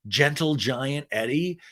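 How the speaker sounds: normal talking voice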